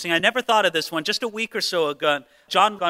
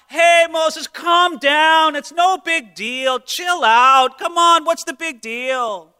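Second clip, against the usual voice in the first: higher, louder breezy brush-off; deflecting the anger; 7 semitones higher, 4 dB louder, slower than usual. Retold or imitated. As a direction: wave it away like it's nothing. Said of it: "tried to deflect the anger".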